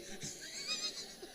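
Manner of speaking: a sudden high squeal of laughter. Real, not acted. Pastor laughing at the congregant's reaction, a high squeal. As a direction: let the laugh burst out high.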